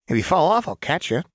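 cheeky reassurance; Jinx voice. Acted.

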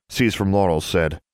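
plain reading voice

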